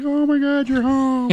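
high, loud, gushing falsetto wife; mock gushing wife. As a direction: squeal it like a thrilled wife. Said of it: "And she's like".

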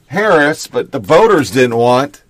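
usual speaking voice

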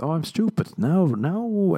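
high, drawn-out mock epiphany; hammed-up moment of understanding; mock realization. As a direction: act out it suddenly dawning on you, pitch swooping up. Acting out a sudden self-realization.

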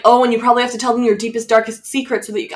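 rattled off quickly; fast, hurried imitation; fast mock explaining. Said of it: "Jughead was like".

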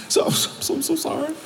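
stammered high, soft weepy whimper; mock crying. Crying husband apologising to his wife, mock tearful. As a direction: imitate a man sobbing out an apology. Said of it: "he's crying".

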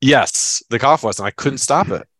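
normal talking voice

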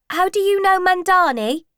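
put-on high, loud teasing teenager; a boy showing off to his mates; cheeky schoolboy. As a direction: pupil teasing the teacher about the vet. Cheeky pupil teasing the teacher.

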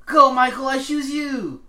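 earnest, determined anime hero shout. Pretend. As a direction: call out your Pokemon like Ash. Pokemon trainer intro bit.

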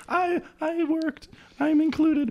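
tiny, very high, slow voice; mock plaintive. Ignored man pleading to be included, comic high small voice. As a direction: squeak it like a forgotten helper. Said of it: "back there going".

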